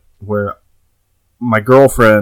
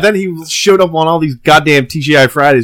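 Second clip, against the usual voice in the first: ordinary delivery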